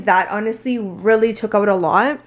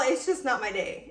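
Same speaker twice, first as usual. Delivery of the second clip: ordinary talk, nothing marked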